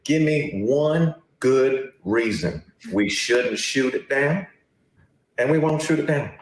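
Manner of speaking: put-on South Carolina accent. Reporter challenging press secretary, put-on Southern accent. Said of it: "probably a terrible South Carolina accent".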